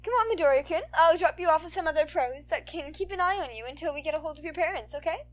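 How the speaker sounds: sultry heroine.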